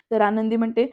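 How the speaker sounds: plain reading voice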